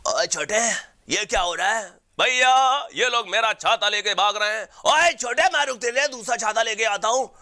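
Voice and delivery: high squeaky cartoon voice; excited cartoon character calling out; cartoon kid voice. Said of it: "जब Jack Oggy को बचाने आता है तब?".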